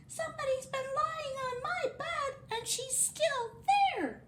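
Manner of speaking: squeaky, very high little wail; Baby Bear. Acted. Baby Bear finds Goldilocks in bed, squeaky wail. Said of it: "wailed Baby Bear in a squeaky little voice".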